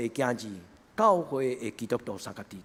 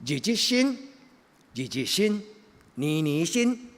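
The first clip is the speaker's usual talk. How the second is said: preaching; 6 semitones higher, 4 dB louder, slower than usual. Acted. Chanted emphatic refrain.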